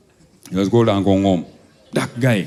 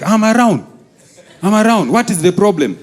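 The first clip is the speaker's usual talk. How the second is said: uncle voice; 8 semitones higher than usual. Retold or imitated. Uncle on phone.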